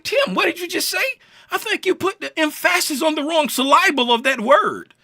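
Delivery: Tim pretends a viewer corrects him, high mock voice. High teasing viewer voice calling out Tim's mistake. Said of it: "You'd be like".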